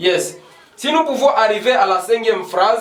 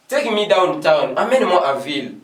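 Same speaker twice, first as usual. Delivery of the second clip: ordinary talk, nothing marked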